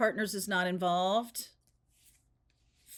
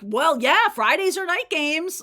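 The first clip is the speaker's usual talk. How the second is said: eager fan voice; 8 semitones higher, 9 dB louder than usual. Retold or imitated. Voicing the old eager fan.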